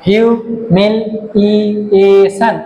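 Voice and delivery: "Humiliation" slowly in separate beats; syllable by syllable. Shown as a demonstration. Teacher marking five syllables, "humiliation" slowly divided. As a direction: give every syllable of "humiliation" its own beat. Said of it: "syllable আছে".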